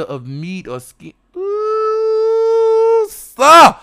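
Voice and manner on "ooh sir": much higher, swooping ooh sir; ecstatic ooh. Real, not acted. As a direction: swoon out loud. Ecstatic recall.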